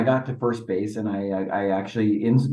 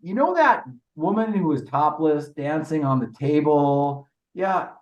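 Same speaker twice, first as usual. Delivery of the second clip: yelling to the dugout; 6 semitones higher than usual. Retold or imitated. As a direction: shout it over to your buddy on the bench. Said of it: "I yelled in my dugout".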